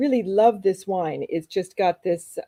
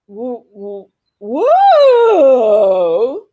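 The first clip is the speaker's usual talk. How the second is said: woo warm-up; 7 dB louder, much slower than usual. Shown as a demonstration. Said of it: "What did you do?".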